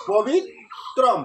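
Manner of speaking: পবিত্রম split slowly before its last syllable; slow syllable-by-syllable model of পবিত্রম; pronunciation demo. Shown as a demonstration. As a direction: say পবি, pause, then ত্রম in পবিত্রম. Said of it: "তাহলে এখানে কি হবে?".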